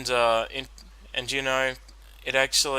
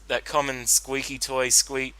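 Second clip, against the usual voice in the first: usual speaking voice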